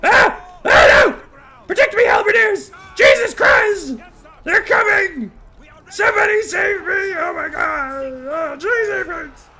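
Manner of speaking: high, loud terrified shrieks; panicked screaming. Acted. Frightened wizard shrieking for halberdiers to save him, panicked. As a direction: scream for help in mock terror.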